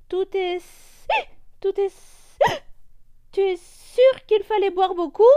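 high whiny voice cut by hiccups; complain, stumbling on hiccups; hiccuping complaint. Acted. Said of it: "se plaint le perroquet". (plainte avec le hoquet)

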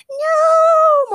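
very high, loud scream; horrified scream. A narrator's reading. Morgana screaming as her cousin is shot. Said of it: "Morgana screamed".

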